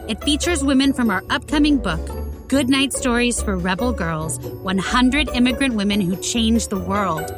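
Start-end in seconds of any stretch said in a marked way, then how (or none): none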